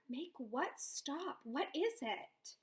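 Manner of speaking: put-on calm a frightened child; gentle concern. A narrator's reading. Grandmother kneels, comforts girl.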